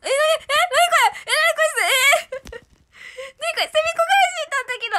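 high squealing shrieks of delight; shrieking delight. Real, not acted. Squealing on seeing the cute collab. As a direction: squeal at the surprise, laughing.

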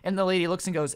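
ordinary talk

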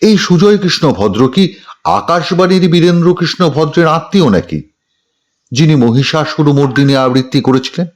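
measured news narration with dramatic questions; news report voice-over. Real, not acted. Reporter telling viewers of the Kalighater Kaku voice sample row, news voice-over. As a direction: read it as a TV news package.

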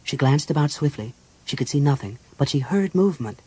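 ordinary delivery, nothing marked

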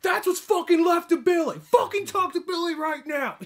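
high, loud manic shout; psychotic yell. Retold or imitated. Trevor showing the bloody shoe to bikers, high manic shout. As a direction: scream the threat like a madman.

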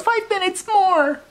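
whining mummy. Acted. Mummy wailing at his servants at bedtime, high whine. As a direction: wail like a kid stalling bedtime. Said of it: "and he wailed".

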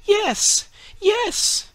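a naive tree marvelling aloud; tree's thoughts. A narrator's reading. Fir tree marvels at the Humpty Dumpty story, high and awed. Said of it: "thought the fir tree".

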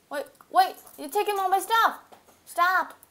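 high, loud, urgent objection; real high-pitched yelp of protest; alarmed protest. Real, not acted. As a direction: call out in a panic to stop a thief. Protesting as game items are taken.

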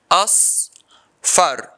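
"asfar" in two separate beats, as, pause, far; syllable by syllable. Shown as a demonstration. Teacher repeating the two syllables, syllable by syllable. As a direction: split "asfar" with a clear gap after as. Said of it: "This word has two syllables".

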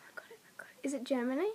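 hushed, excited-to-guess whisper. Real, not acted. Player whispers a guess at the language, whispered.

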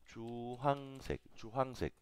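Chuhangsek drawn out slowly, chu-hang-sek with a ch; teacher spells out Chuhangsek slowly; slow model pronunciation. Shown as a demonstration. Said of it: "Yo lo pongo doble L".

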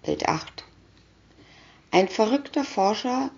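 plain reading voice

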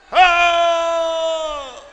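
very loud long high shout; booming shepherd oh; shepherd's shout. Acted. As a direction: answer the wolf with a mighty call. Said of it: "¿cómo le hacía?".

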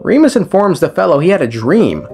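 ordinary talk, nothing marked

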